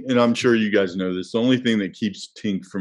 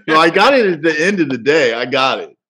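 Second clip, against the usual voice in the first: excited; 6 semitones higher, 8 dB louder, faster than usual. Real, not acted. Defending himself after the teasing.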